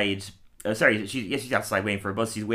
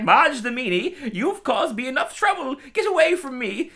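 put-on stilted old movie melodrama; 4 dB louder than usual. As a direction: scold like a fifties movie matron. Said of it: "really old-fashioned, like, nineteen fifties voice".

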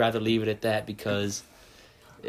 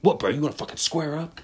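mock touchy guy; 5 semitones higher, 3 dB louder than usual. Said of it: "they'd be like".

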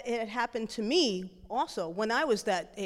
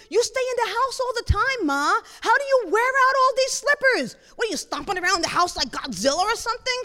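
high, loud nagging daughter; hammed-up incredulous complaint; comic exasperation; 12 semitones higher, 7 dB louder than usual. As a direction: rant at Mom in mock disbelief. Daughter teases mother about worn slippers.

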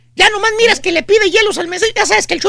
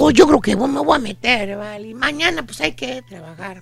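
low, drowsy mumble trailing away; half-yawning; 7 semitones lower, 6 dB quieter than usual. Acted. Drinker excusing himself to go to bed, half-yawning. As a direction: act like a sleepy drinker begging off. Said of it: "medio bostezeando, te dice".

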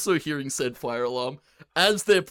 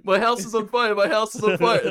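imitating gamer; 6 semitones higher, 5 dB louder than usual. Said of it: "him going".

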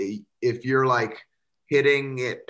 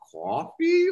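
sky-high, squeaky mock waitress; startled waitress. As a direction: squeak the word like a startled server. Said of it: "Like that".

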